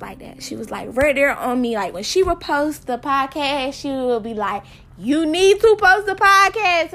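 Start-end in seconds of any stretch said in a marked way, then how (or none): 5.0-6.9 loud, high-pitched nagging; insistent mock order from a best friend; pushy friend. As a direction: imitate a friend insisting, voice raised. Retold best friend pushing her.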